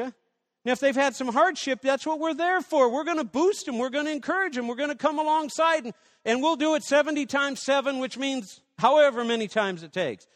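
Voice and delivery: rally the church to encourage; fervent preaching. Acted. Preacher urging the congregation, high and loud.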